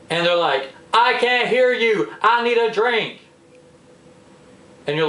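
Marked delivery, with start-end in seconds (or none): 0.9-3.2 high, slow mock holler; too-loud friend. Speakerphone friend with parents sleeping, hollering loudly. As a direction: call out obliviously, drawn out and high-pitched. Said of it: "they just get louder and louder and louder".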